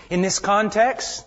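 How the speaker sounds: ordinary talk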